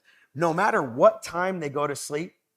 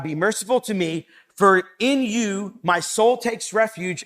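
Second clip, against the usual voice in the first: louder, impassioned reading of the psalm; fervent scripture reading; 4 dB louder than usual. Acted. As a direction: read it as a heartfelt plea. Reading psalm aloud with fervour.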